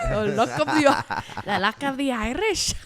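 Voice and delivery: put-on high, loud hammy Irish accent. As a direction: overdo a comic Irish accent. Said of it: "in an Irish accent".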